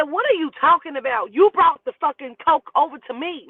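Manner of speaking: high, loud, indignant pushback; indignant retort. Retold or imitated. Daughter answers daddy's accusation, indignant and loud. Acted quarrel with daddy on phone.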